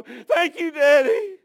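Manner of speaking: put-on high, loud little-girl wail; imitated crying child. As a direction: cry it out like a hurting toddler to Daddy. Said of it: "she'd cry, and she'd go".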